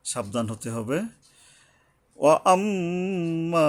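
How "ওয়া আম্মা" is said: ওয়া আম্মা higher and slow, long hummed m; gunnah then stretch. Shown as a demonstration. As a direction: hum the mim of ওয়া আম্মা, then lengthen মা. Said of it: "গুণনা করে আবার এক আলিফ টানতে হবে". (ওয়া আম্মা গুন্নাহ করে ও টেনে পড়া)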